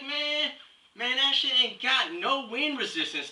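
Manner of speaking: high-pitched street-slang impression; mock Strama guy. Strama guy bragging to his bro about headgear, high put-on voice. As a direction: play a hammed-up slang-talking dude. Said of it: "huge mothers that are like".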